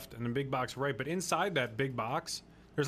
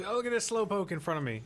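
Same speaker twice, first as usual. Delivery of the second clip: mock exasperation, pitched higher; impatient driver; 9 semitones higher, 3 dB louder than usual.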